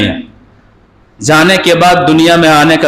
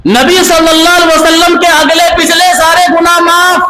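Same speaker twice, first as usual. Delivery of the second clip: raised, driving sermon voice, pressing each line home; impassioned preaching; 10 semitones higher than usual. Acted. Preacher scolding listeners for not living by Quran, fervent preaching. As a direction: preach it at full force to the crowd.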